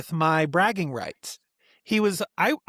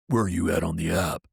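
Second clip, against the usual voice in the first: gruff brother; 11 semitones lower than usual. Retold or imitated. Brother's demanding text, retold.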